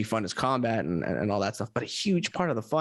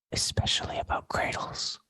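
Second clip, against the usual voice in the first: soft breathy whisper aside; 3 dB quieter than usual. Acted. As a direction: whisper it like a secret. Whispered plug after outro.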